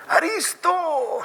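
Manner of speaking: long, high-pitched drawn-out call; mock yell across centuries. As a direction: call him from twenty centuries away. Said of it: "Le grita".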